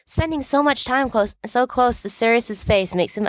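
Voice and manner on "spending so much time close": ordinary delivery, nothing marked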